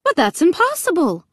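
high, alarmed disbelief; mother crying out, stunned; cried out in shock. A narrator's reading. Mother cries out at locked gate, alarmed. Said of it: "Mom cried".

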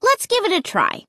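put-on high, persuasive kid; eager, encouraging urging. A narrator's reading. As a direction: coax them with pitch way up. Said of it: "I urged them".

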